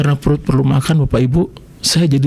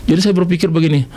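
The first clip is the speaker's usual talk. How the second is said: usual speaking voice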